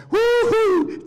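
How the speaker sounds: high slow woo-hoo, very loud; joyful whoop. Retold or imitated. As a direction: whoop for joy up high and drawn out. Jumps up in joy.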